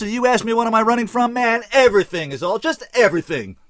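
loud, high-pitched outraged rant; exploding. A narrator's reading. Bottled-up kid rails at his life, exploding. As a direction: let everything bottled up burst out. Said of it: "He exploded".

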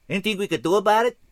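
Fast Eddie sings out his question; calling out. A narrator's reading. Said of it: "Fast Eddie sang out".

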